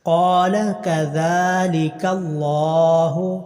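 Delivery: slow drawn-out tajweed chant; slow tarteel recitation; Quran recitation. Shown as a demonstration. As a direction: recite each vowel long and measured. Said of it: "قاف کو لمبا کرنا".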